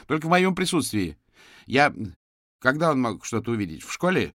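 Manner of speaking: a hint of a high, agitated mother; protective mother. A narrator's reading. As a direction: press the detective as a worried mother. Protective mother questions the detective.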